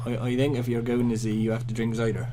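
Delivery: mock West Country burr, rolled r on cider; playful cider-drinking yokel accent. Joking with co-host about Z in a mock West Country accent. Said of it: "Back to our refined English accents".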